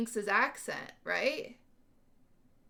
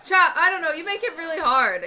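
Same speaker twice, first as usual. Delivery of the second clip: loud, higher, fed-up complaint to chat; exasperated outburst; 5 semitones higher, 10 dB louder than usual. Real, not acted. Streamer scolding her chat, loud and exasperated. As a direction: throw up your hands at chat.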